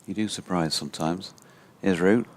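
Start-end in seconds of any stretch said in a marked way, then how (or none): 1.8-2.3 louder, lifted ball-by-ball call; live cricket commentary. Acted. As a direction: build the call as the batsman charges.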